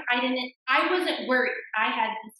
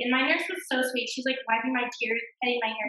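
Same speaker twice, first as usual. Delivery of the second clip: ordinary talk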